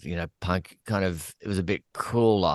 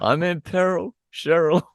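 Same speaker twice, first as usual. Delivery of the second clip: higher-pitched mock cry of peril; Gareth Evans imitation; 9 semitones higher, 4 dB louder than usual. Quoting a musical's line to show it's Australian, hammed-up imitation. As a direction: quote it high, hamming up the Australian. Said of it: "that's Australian".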